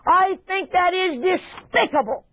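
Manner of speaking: high, drawn-out disdain; scornful disgust. Acted. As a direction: act out a wife's contempt, slowly. Acts out David's scornful wife.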